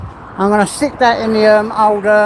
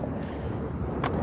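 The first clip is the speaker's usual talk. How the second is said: soft breathy laugh; 15 dB quieter than usual. Real, not acted. Laughs at own meds joke.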